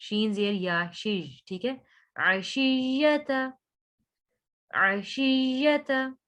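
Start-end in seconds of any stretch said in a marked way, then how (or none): 2.1-6.1 slow, drawn-out tajweed recitation; Quran recitation. Shown as a demonstration. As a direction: recite it with ghunna on the noon. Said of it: "शीन को या के साथ मिलाना है आपने". (धीमी तजवीद वाली तिलावत)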